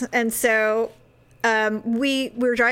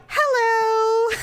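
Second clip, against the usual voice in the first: fake cheery; 11 semitones higher than usual. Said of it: "I'm like".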